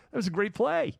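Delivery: high-pitched acted enthusiasm; happy fan. Sports fan turning to the stranger beside them, acted excitement. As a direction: play a fan thrilled by the game. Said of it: "when you hear happy cheers".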